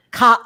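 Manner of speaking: "ca" said with no r at the end, open ah vowel; imitating a friend's Connecticut "ca" with the r dropped; dropped r accent. Host mocking her friend's accent, saying "ca" without the r. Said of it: "She says, like".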